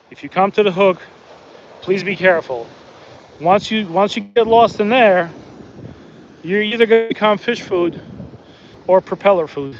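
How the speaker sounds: usual speaking voice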